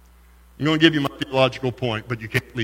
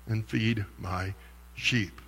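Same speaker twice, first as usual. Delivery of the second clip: hushed Jesus reply; 7 semitones lower, 14 dB quieter, slower than usual. Retold or imitated. Preacher voicing Jesus softly to Peter, hushed. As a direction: drop to a soft, slow hush.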